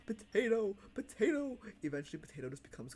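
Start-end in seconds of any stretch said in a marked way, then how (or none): 0.1-1.6 excited call. Real, not acted. Cheering a chat cult.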